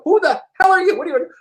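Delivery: high-pitched mock affront; affronted challenge. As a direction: imitate strangers challenging an intruder. Booth strangers react to him barging in.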